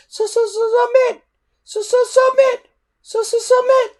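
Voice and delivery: very high, loud stuttering shriek; a tiny dragon screeching in his face. A narrator's reading.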